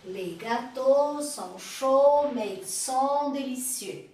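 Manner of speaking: slow, careful French for repeating; slow model sentence. Shown as a demonstration. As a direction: lay out each word slowly. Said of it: "Então, répétez, s'il vous plaît". (devagar, para repetir)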